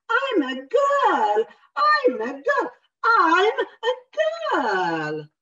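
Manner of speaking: high, loud, slow protest; hammed-up child insisting; insistent girl. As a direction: stamp your foot and insist. Girl insists, voiced in story.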